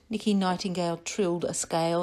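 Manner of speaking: ordinary delivery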